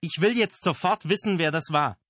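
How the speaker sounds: high, loud furious bellow. A narrator's reading. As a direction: bellow the demand. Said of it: "brüllte er noch einmal".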